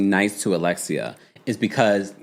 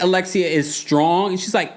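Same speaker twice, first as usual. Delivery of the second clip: ordinary talk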